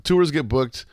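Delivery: ordinary talk, nothing marked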